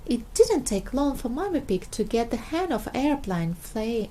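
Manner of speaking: plain reading voice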